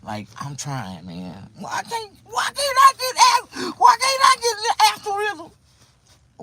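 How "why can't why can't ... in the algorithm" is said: hammed-up crying shout, very high and loud; mock wail. Streamer wails at his viewers in mock despair, very high and loud.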